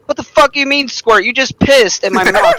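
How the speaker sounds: hammed-up disbelief, pitched high; mock indignation. Retold indignant reply.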